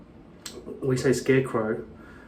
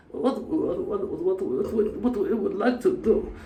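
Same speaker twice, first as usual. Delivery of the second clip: high, softer mock Russian accent; 6 semitones higher, 5 dB quieter, slower than usual. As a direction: stammer it out in a put-on Russian accent. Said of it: "A Russian talking to a German".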